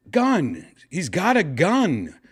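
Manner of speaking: high-pitched mock yell of alarm; imitated scream. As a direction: cry out a warning, imitating a screaming man. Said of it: "he was just screaming".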